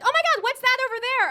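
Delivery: high, loud gushing question; excited tourist. Acted. Voicing visiting friends on tour.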